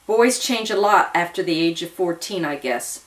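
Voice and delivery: usual speaking voice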